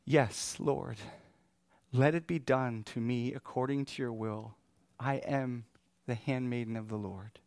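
soft, reverent surrender; humble awe. Acted. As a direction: say it hushed, like Mary submitting to God. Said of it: "with humility, with awe".

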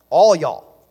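all y'all in a loud, exaggerated Southern drawl. Shown as a demonstration. Preacher to congregation, hamming up a Southern all y'all. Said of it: "as we say".

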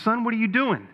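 storyteller's mock-astonished pastor; acted astonished question. Pastor questioning boy throwing hay.

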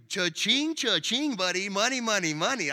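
lightly voiced schemer dreaming of money; mock greedy sing-song; greedy Laban. Greedy Laban's inner cash thoughts.

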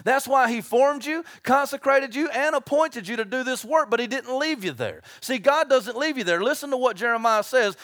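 ordinary delivery

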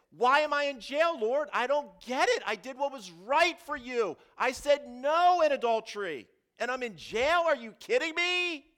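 loud, high, exasperated protest to God; indignant rant. Retold or imitated. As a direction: rail at God, unable to believe it. Joseph voiced ranting in jail.

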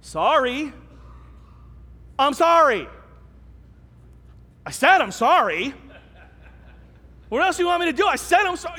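grudging, exasperated sorry; angry apology. Retold or imitated. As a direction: snap out a resentful apology. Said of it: "somebody just go".

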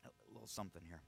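confiding. Real, not acted. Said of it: "let you in on a secret".

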